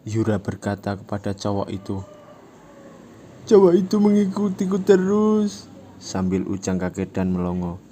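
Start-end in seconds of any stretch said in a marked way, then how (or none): 3.5-5.7 high, louder whiny fake tears; fake pleading. Yura complaining to the fat guy, fake pleading. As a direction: play the victim with put-on crying. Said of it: "Yura akting menangis sambil memelas".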